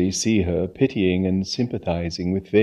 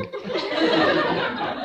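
hearty laugh; 8 dB quieter than usual. A narrator's reading. Aunt's loud laugh.

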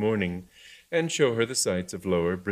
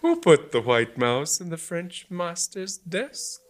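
malevolent taunt; 9 semitones higher, slower than usual. Acted. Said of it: "laughed malevolently".

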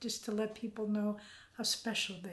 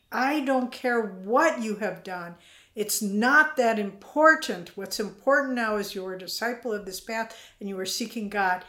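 loving reassurance; 2 semitones higher, 10 dB louder than usual. Retold or imitated. Swami's wordless message voiced.

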